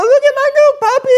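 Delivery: very high, loud, fast squeal; hammed-up puppy bragging; mock excited gush. Puppy owner running around showing off, high loud squeal.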